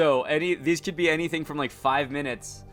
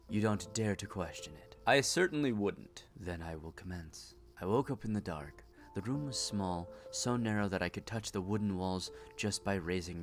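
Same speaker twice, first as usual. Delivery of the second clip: quiet troubled woman; 2 semitones lower, 9 dB quieter than usual. Acted. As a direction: tell it like a haunted confession. Voices patient telling her story.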